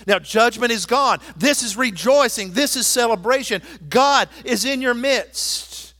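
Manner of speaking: loud, high, proclaiming preacher voice; full-force preaching. Acted. Pastor rousing the church about conquered enemies, full-force preaching. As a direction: preach it out to the whole congregation.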